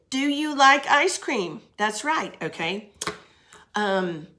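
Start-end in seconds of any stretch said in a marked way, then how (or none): 0.1-1.6 lively question. Shown as a demonstration. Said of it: "That is a question".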